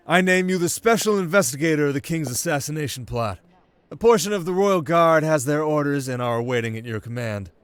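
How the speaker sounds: a commanding prince announcing a decision; prince's voice. Acted.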